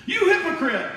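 high, loud, hammed-up yell; mock accusing shout. Preacher mocking a zealot accusing shoppers, hammed-up shout. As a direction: play the self-righteous zealot, shouting it out.

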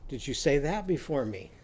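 normal talking voice

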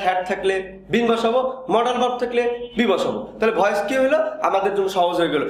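ordinary delivery

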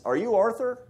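lightly put-on Texas accent, pitched high; a touch of mock Texas twang. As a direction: imitate a Texan greeting, high-pitched. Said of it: "in a Texas accent".

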